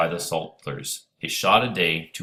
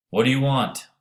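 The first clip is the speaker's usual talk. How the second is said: calling out loud; 8 semitones higher, 6 dB louder than usual. Acted. Charlie calls back across river.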